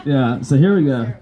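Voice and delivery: ordinary talk, nothing marked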